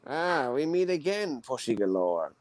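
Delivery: low, quiet mock Bond villain; a hammed-up supervillain greeting. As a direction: purr it like a smug movie villain. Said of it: "Does he think he's a Bond villain?".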